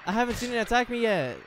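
loud high frightened yelling; panicked shouting. Real, not acted. Gamer attacked by a revived monster, shouting.

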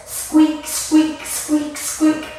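high little squeaks on a beat; mouse squeaks. Shown as a demonstration. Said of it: "It can sound like this".

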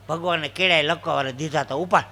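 ordinary talk, nothing marked

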